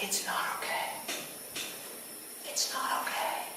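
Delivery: breathy despairing whisper, barely voiced; grieving whisper. Laboring mother whispers her fear to the midwife, despairing whisper. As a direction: whisper it through rising panic and grief.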